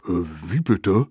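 puzzled, hesitant mammoth; mildly surprised. A narrator's reading. Mammoth with grass in its ears, mildly surprised. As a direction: ask as if you missed everything. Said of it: "fragte es überrascht".

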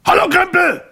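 very high, loud shouted greeting; shouting. A narrator's reading. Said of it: "schrie er".

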